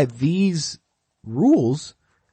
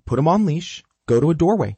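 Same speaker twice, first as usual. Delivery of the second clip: normal talking voice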